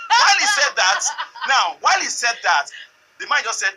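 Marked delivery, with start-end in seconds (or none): 0.1-1.0 words breaking into laughter; can't hold back the laugh. Real, not acted. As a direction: laugh through the line, loud. Laughing while retelling the story.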